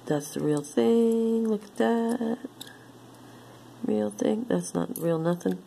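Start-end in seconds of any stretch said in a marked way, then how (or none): 0.6-4.5 gasped, high amazement; thrilled at the find; delighted surprise. Real, not acted.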